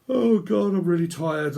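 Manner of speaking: hammed-up tired complaint; exasperated groan. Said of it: "I'm like".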